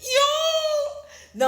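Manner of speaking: high, drawn-out cry of disbelief; shocked exclamation. Real, not acted. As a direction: let out a long, high "yo" in shock. Reacting to a shocking money transfer.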